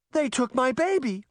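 high, panicked cry of a mother; frantic mother's cry. A narrator's reading. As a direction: cry it out in panic for a stolen baby. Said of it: "Veronica cried. Her voice shook with panic".

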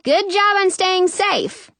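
high, stretched call. A narrator's reading. Sister to the girl inside, calling out. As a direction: project it cheerily toward a window. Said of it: "I call".

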